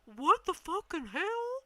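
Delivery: high, slow furious disbelief; a man cursing his iced-over car; baffled swearing. Retold or imitated. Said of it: "he's out there going".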